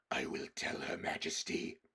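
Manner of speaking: breathy, menacing wolf whisper. Acted. Wolf guard answering Edmund, whispered menace. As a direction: hiss it low and cold.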